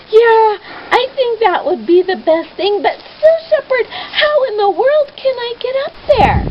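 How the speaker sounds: high, tearful pleading; frightened girl pleading how to climb; anxious tearful question. Acted. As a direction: ask through rising tears. Much Afraid anxious, tearful.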